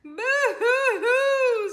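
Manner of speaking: high, loud, slow mock wail; boo-hoo like a cartoon crybaby; hammed-up sobbing. A narrator's reading. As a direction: dogs sobbing in fear of the monster. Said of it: "sobbed the dogs".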